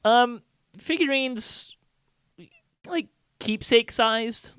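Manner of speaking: high, slow dainty voice for small things; tiny voice. Acted. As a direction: say it small and precious. GM describes small size.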